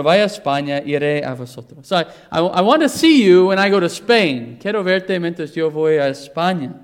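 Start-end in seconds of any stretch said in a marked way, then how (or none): none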